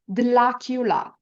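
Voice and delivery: Dlacula said slowly with a clear L; L in place of r. Shown as a demonstration. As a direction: Dlacula with the tongue tip at the front. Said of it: "replace the letter R in Dracula with the letter L".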